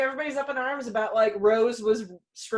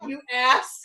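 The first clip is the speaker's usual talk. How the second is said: mock angry rant; 6 semitones higher than usual. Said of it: "I'm like".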